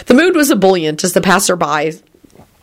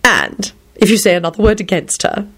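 decisive; 5 semitones higher than usual. A narrator's reading. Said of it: "he asserted decisively".

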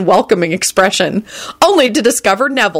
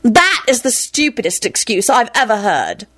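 higher-pitched scornful dismissal; disgusted; 5 semitones higher than usual. A narrator's reading. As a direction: tear down the excuse with disgust. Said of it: "Rosie rejoined disgustedly".

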